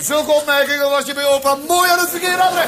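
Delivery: high mock outrage, rising at the end; indignant rebuke. Grandpa after a cheeky remark, indignant. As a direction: thunder it out in comic indignation.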